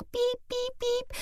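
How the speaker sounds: high, even electronic beeping; imitated till beeps. Shopper loading the conveyor belt, imitating the beeps.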